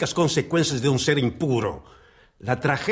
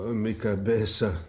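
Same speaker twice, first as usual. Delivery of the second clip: pained groan; 5 semitones lower, 7 dB quieter than usual. Acted. Sein Kopf bringt ihn um.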